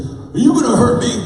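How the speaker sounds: put-on high, loud, whiny kid voice; scared teen girl. Teen patient asking the new chiropractor, high whiny fear. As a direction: plead like a nervous fourteen-year-old.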